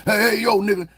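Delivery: high, loud mock outburst; imitated confrontation. Man turning on his own people, mock-aggressive shout. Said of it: "But when he talking to you".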